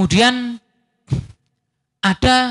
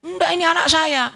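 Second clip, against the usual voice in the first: higher insistent claim; a higher pitched insistence; insisting mother; 8 semitones higher than usual. Retold or imitated. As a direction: stake your claim higher. Sisters arguing over the baby.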